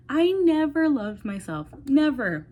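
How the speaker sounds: loud, high, slow emphasis on never; emphatic. Real, not acted. Vlogger confessing to her viewers, emphatic and loud. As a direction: drive it home, stressing NEVER.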